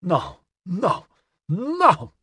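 high, drawn-out wail of dismay; moaning. A narrator's reading. Custine staring down at his wrecked double bass, moaning. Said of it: "his moaning formed into coherent sounds".

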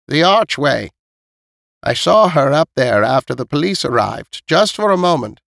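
put-on old woman setting the facts straight; matter-of-fact old woman. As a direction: tell the grim detail as an elderly neighbour. Voices old Missus Knight.

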